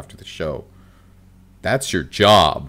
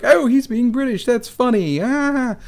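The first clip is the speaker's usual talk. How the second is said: very high, louder mock spectator voice; imitated amused audience; 4 dB louder than usual. Audience member reacting to a British character on stage, high-pitched mock delight. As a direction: squeal it like an easily pleased theatregoer.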